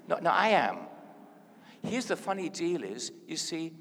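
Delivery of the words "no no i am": louder insistence with AM punched; emphatic correction landing on "am"; stronger, stress on AM. Shown as a demonstration. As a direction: hit the word "am" hard, then let it fall. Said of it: "say it a bit stronger".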